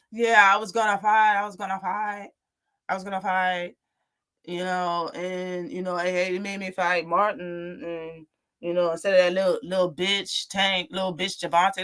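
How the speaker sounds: mock a mumbled interview answer, low and droning; Riviera mumbling. Boxer mumbling excuses to an interviewer, mocked as a low mumble. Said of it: "interview mumbling and shit with that sorry ass accent".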